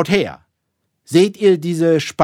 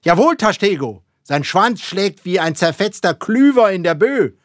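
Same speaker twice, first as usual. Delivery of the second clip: high, loud, rousing captain; excited captain's proclamation; fervent confirmation; 6 semitones higher, 6 dB louder than usual. A narrator's reading. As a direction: confirm each point with mounting fervour. Ahab excitedly confirming the crew's descriptions.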